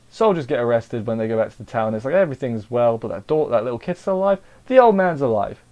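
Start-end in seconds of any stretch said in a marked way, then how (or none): 3.5-5.6 raised pitch, growing louder; excited reveal. Retold or imitated. Reviewer revealing the old man survived, excited. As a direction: announce it as a happy surprise.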